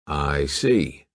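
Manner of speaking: dry. Acted. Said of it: "said Fox dryly".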